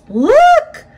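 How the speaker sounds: astonished shout. A narrator's reading. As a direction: call out to passers-by, stunned. Woman spots man on wire.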